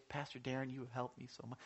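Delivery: quietly imitating a grateful member; soft grateful quote. Church member thanking Pastor Darren, hushed and soft.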